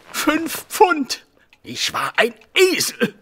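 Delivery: high, wailing cry over the lost money; a high wail of self-reproach; anguished outburst. Acted. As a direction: moan it out in despair. Inspector lamenting his lost bet.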